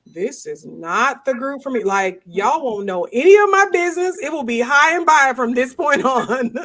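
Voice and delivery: mock-firm inner vow, laughing at the end; telling myself off. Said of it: "I was sitting there going".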